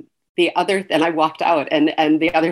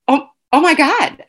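stunned; 10 semitones higher, 6 dB louder than usual. Retold or imitated. Overwhelmed at being told to write.

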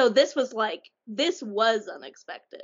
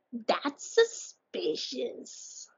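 suspicious; 4 semitones higher, 5 dB quieter, much slower than usual. Real, not acted. Listener teasing about the plot twist.

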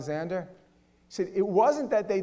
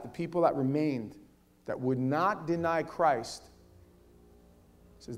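ordinary talk, nothing marked

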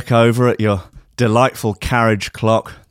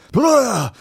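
high, jolted cry; startled waking gasp; 11 semitones higher than usual. Acted. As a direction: jolt awake from a nightmare.

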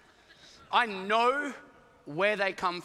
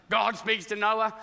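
normal talking voice

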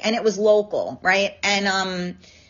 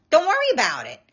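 exasperated retort; 5 semitones higher than usual. Retold or imitated. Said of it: "I'm like".

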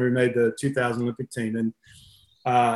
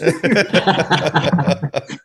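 long burst of laughter; real laugh; 3 semitones higher than usual. Real, not acted. Storyteller laughing about the coach's name mix-up, real laughter. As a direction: laugh hard at your own anecdote.

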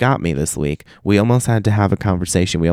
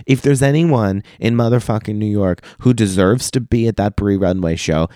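normal talking voice

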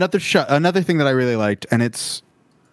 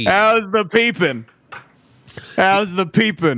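higher, drawn-out imitated shout; Philip Seymour Hoffman shout; 4 semitones higher, slower than usual. Repeating a film line reading, slow mock shout.

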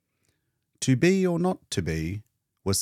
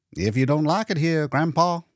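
higher, louder teasing jab; cheeky young orderly; 8 semitones higher, 4 dB louder than usual. Acted. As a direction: rib the old man, pitched up. Orderly teasing old painter.

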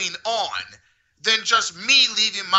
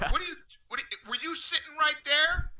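imitated staffer; 7 semitones higher, 6 dB quieter than usual. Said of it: "and I hear".

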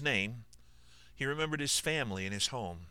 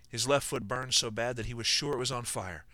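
ordinary delivery

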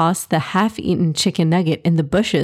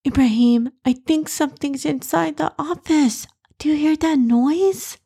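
put-on higher-pitched startled little girl; 8 semitones higher than usual. A narrator's reading. Noor to Ibrahim about a noise inside, startled. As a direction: voice a child alarmed by a noise, pitched up. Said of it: "Noor looked really startled".

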